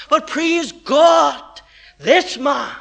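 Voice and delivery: slow, shouted "praise God". Real, not acted. Preacher exclaiming praise.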